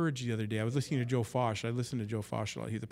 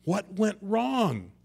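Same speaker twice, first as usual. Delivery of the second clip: slow, higher and louder, "wrong" drawn out in dismay; anguished question; 7 semitones higher, 6 dB louder, much slower than usual. Retold or imitated. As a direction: ask "What went wrong?" slowly, high and loud. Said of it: "He's basically saying".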